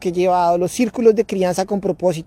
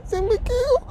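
put-on much higher, soft, timid child voice; a frightened kid owning up; scared little boy; 5 dB quieter, slower than usual. Son admitting he forgot his planner, timid and scared. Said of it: "noté sus ojitos de".

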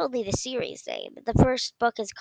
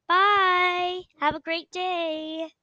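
high, bright bye to listeners; cheery sign-off; 8 semitones higher, much slower than usual. Real, not acted. Podcaster signing off to her listeners, cheerful high goodbye.

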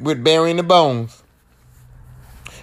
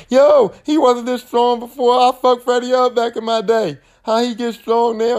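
high, slow puzzled voice; hammed-up confused girl; mock Nancy; 8 semitones higher than usual. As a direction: wonder aloud how he got stronger. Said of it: "And Nancy like".

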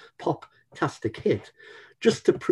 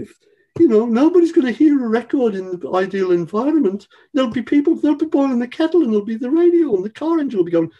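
much higher and louder Brummie voice, quick and lecturing; 12 semitones higher, 9 dB louder, faster than usual. Retold or imitated. Record boss lectures the band in a high, loud Brummie accent. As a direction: play the Brummie record boss telling the band to get over themselves. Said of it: "put on [name]'s accent".

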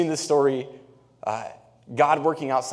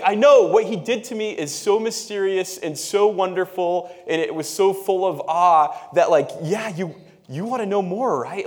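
higher and louder, full of wonder; excited blind man; 7 semitones higher, 6 dB louder than usual. Retold or imitated. Healed blind man telling the Pharisees about the miracle, high and eager. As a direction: gush with innocent awe. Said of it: "but he's saying, like".

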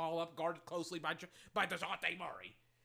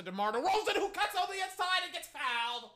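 excited play call; 9 semitones higher, 5 dB louder than usual. Real, not acted. Fan calls his player driving inside, excited shout. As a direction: voice leaping high and loud.

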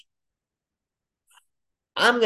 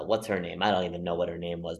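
ordinary talk, nothing marked

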